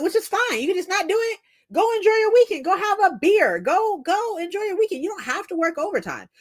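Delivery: high-pitched, insistent retort; retelling a worked-up dismissal; exasperated argument. Retold or imitated. Retells arguing with a coworker.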